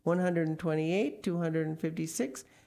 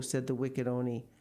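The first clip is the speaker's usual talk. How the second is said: usual speaking voice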